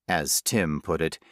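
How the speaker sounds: plain reading voice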